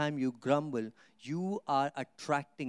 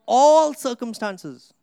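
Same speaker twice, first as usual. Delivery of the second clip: "all circumstances" shouted much louder, higher, slowly; emphatic shout; 7 semitones higher, 12 dB louder, much slower than usual. Acted. Preacher hammers key phrase.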